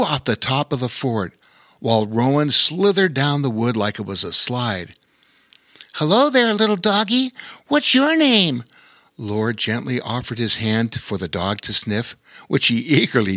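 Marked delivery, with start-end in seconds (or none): 6.0-8.6 put-on high sweet boyish greeting; young boy voice. A narrator's reading. Lore greeting the frightened dog, high gentle voice. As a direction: coax the dog kindly.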